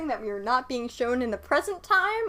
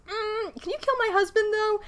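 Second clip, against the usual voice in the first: high-pitched sweet coy request; 11 semitones higher than usual. Acted. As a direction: ask it sweetly and coyly. Wife asking sweetly.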